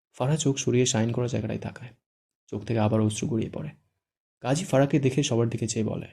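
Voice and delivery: ordinary delivery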